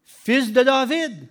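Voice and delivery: loud pleading call, slow on fils de David; crying out. A narrator's reading. Blind Bartimaeus begging Jesus, a loud plea. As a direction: call across the crowd for mercy. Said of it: "Et il cria".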